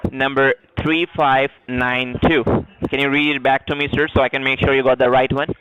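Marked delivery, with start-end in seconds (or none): 0.1-2.4 slow and distinct, digit by digit; dictating. Acted. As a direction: spell it out carefully for a note.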